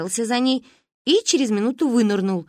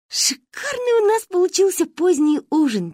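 giggling; 11 semitones higher, 4 dB louder than usual. Acted. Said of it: "тихонечко хихикали мы".

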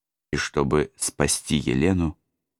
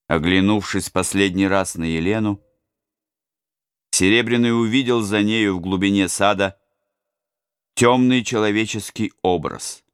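louder, higher storytelling; tense narration; 5 semitones higher, 4 dB louder than usual. A narrator's reading. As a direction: raise the tension. Dramatic narration.

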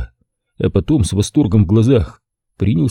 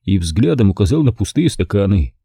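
ordinary delivery, nothing marked

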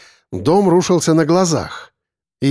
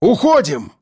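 raised pitch, urgent call to leave; mild shout; 4 semitones higher than usual. A narrator's reading. Narrator urging captives to leave, mild shout. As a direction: call it out, contained. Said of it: "крикнул я".

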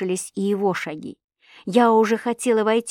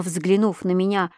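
ordinary delivery, nothing marked

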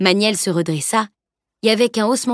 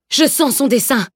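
raised, higher proclamation; an old master proclaiming, raised voice; proclaimed; 5 semitones higher than usual. Acted. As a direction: announce it to the whole courtyard. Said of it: "clama-t-il".

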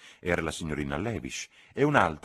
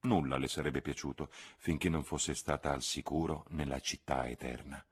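usual speaking voice

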